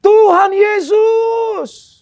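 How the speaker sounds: high, drawn-out cry at full volume; imitating a friend's loud cry to God; retold desperate shout. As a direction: shout it out suddenly, stretching each word. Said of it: "tiba-tiba dia teriak di mobil saya".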